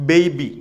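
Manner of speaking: ബേബി with a gliding "ey" vowel; correct pronunciation. Shown as a demonstration. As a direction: say ബേബി as "bey-bee". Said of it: "അങ്ങനെയാണ് ഉപയോഗിക്കുന്നത്".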